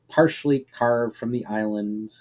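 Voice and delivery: ordinary delivery, nothing marked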